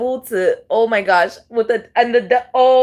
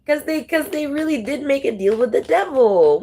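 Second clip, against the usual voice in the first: usual speaking voice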